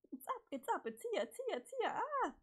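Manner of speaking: high, rapturous repeated exclamations ending in a cry; excited squealing. Acted. Acted excitement at mail arriving.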